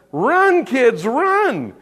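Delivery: high, loud panicked cry; panicked mom. Retold or imitated. Mom shouting at kids to flee the skunks, panicked. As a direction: yell at the kids to run in alarm. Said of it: "panicked, and she said".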